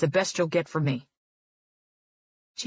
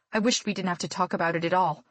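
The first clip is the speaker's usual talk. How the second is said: plain reading voice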